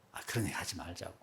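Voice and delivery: soft, low, quick objection; quietly steer them off it; gentle dissuading. Retold or imitated. Pastor to colleagues cursing politicians, softly dissuading.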